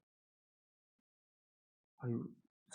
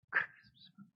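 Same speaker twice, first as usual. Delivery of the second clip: whispered; 6 dB quieter, faster than usual. Shown as a demonstration. Repeat of phrase, whispered.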